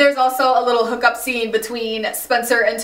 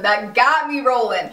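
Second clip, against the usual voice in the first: ordinary delivery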